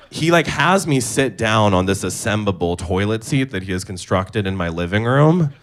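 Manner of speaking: ordinary talk, nothing marked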